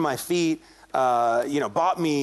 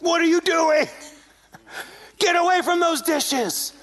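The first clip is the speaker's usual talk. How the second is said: high, raised-voice outburst, imitating an angry friend; mad; 10 semitones higher than usual. Angry friend catching him at the sink, retold as mad. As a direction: act out an indignant telling-off. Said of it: "He's mad, and he's like".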